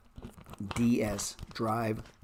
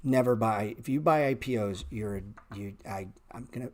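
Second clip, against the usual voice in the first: ordinary talk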